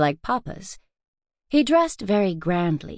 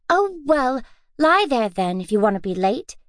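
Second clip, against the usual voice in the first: put-on young girl voice; 5 semitones higher than usual. Girl scolds lazy sister.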